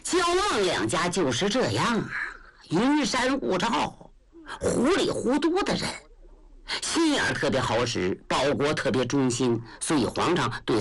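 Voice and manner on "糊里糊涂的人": ordinary delivery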